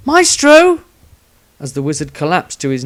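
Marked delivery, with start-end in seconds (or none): 0.1-0.8 high, loud cry of alarm; calling out. A narrator's reading. Rysandria calling to the collapsing wizard, high alarmed shout. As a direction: shout his name sharply. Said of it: "Rysandria called out".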